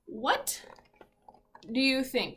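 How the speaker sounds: ordinary talk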